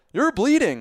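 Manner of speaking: very high, loud exclamation; alarmed passer-by. Retold or imitated. Dog walker looking down at the swimmer's foot, startled. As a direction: imitate a stranger's startled discovery.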